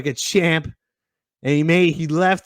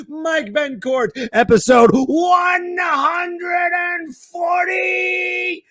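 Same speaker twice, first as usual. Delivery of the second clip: hyped host welcome; 7 semitones higher than usual. Acted. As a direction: welcome everyone like a game show host.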